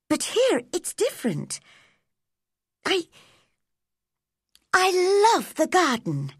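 put-on high, earnest girl's voice, halting towards the end; going on bravely. A narrator's reading. Girl telling her guardian why she loves gardens, going on bravely. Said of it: "Mary went on bravely".

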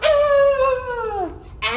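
long, high sliding vocal noise; stretching "ah". Shown as a demonstration. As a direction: let a high sound slide down with the stretch. Demonstrates leaning over in stretch.

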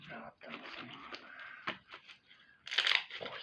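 soft breathy ASMR whisper. Real, not acted. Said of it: "A S M R gibi".

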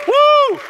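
very high, loud whoop; ringing celebratory woo; loud cheering woo. Real, not acted. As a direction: let out a big high cheer. Cheering with the audience.

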